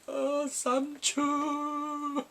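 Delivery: high, drawn-out imitation wail; hammed-up crying, stretched very slow; mock bawling. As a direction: wail the name like an overdone sob. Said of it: "just bawling his eyes".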